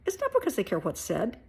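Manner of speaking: ordinary talk, nothing marked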